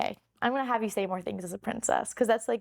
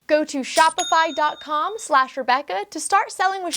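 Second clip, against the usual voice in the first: loud, upbeat sponsor read; 5 semitones higher, 9 dB louder than usual. Acted. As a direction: pitch the sponsor with energy. Repeated URL in ad.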